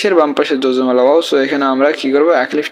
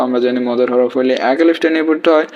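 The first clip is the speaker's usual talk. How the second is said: ordinary talk, nothing marked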